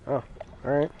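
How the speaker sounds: ordinary delivery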